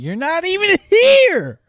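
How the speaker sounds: high, drawn-out yell; outraged shout. Real, not acted. Co-host berates absent friend on the phone, outraged shout. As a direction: yell it at him in protest. Yelling at friend who didn't come.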